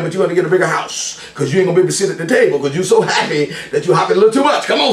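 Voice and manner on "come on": usual speaking voice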